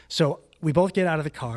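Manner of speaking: ordinary talk, nothing marked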